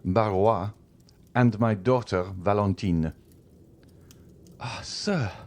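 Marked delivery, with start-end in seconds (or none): 4.6-5.3 high, breathy-free startled gasp of words; startled exclamation. A narrator's reading. Wife startled by her husband's accusation, high exclamation. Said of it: "exclaimed Madame de Villefort".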